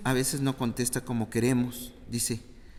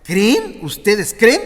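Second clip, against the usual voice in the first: high, loud rising challenge; urgent emphasis; 8 semitones higher, 12 dB louder, slower than usual. Acted. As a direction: press the crowd on believing. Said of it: "O sea".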